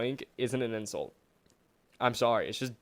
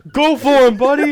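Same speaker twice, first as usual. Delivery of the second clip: mock cheer; 13 semitones higher, 13 dB louder than usual.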